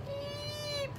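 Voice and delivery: high, soft little beep; mouse squeak. Acted. The little mouse in the picture, lightly voiced high beep. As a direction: peep like a tiny mouse. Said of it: "The little mouse down here is going".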